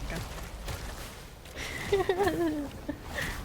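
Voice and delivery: high, unvoiced giggle; breathless laugh. Real, not acted. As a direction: giggle under your breath. Laughing at teammate.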